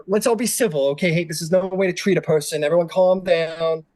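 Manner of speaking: fussy doctor calming a room; nervous doctor. Acted. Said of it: "he goes".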